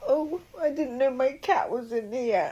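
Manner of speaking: high, tearful, wobbling words; crying through the words; ugly crying. Real, not acted. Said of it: "I'm doing really ugly crying".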